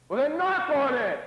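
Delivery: loud, slow preaching cry; emphatic urging. Real, not acted. Preacher urging the congregation, loud and slow. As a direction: drive home the call to pray.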